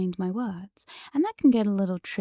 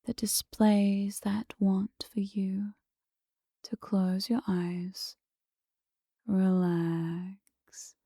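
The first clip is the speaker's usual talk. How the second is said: slow, whispery trance induction, sinking at the end; hypnotic soothing; 4 dB quieter, much slower than usual. Shown as a demonstration. Hypnotist coaxing the listener to close their eyes, soothing. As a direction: draw each phrase out to relax the listener.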